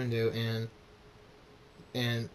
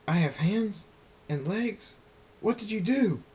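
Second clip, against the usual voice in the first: high, loud, disbelieving wail; panicked, high-pitched outburst; horrified screaming; 9 semitones higher, 5 dB louder than usual. A narrator's reading. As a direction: cry out in shock at your own body. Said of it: "Draconix lifts his hooves and screams".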